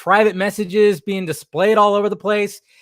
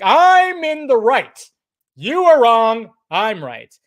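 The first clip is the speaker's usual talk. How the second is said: mock self-righteous; 6 semitones higher, 4 dB louder, slower than usual. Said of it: "puffing their chests like".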